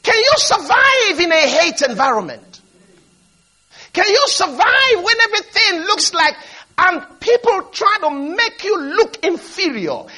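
emphatic preacher addressing a congregation; impassioned preaching. Real, not acted. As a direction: preach with force, stretching the big words. Preaches at full force.

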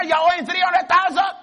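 a touch of a loud debt caller; mock caller's threat. Caller telling the listener the debt is due. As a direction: push the claim at them. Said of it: "some fellow phoned you and said".

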